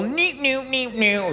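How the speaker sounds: high, soft squeaky neeps; little alien noises. Retold or imitated.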